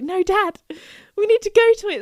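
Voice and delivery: pleading with Dad. Retold or imitated. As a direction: beg Dad like a kid, pitch up. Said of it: "It's like".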